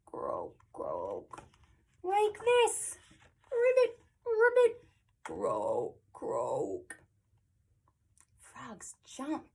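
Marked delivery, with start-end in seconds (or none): 0.1-1.4 very low, soft croak; croaky frog. Acted. Reader shows children a frog's hello, deep croaking. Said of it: "and croaky". 2.0-4.8 high, loud springy ribbits; jumpy frog. Acted. Reader shows children a frog's hello, high and jumpy. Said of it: "A frog hello is jumpy". 5.2-7.0 croaky frog. Acted. Reader shows children a frog's hello, deep croaking. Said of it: "and croaky".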